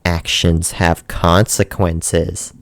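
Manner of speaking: low, slow villain line; ominous low drawl of a warning; menacing voice. Retold or imitated. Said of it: "in this menacing voice".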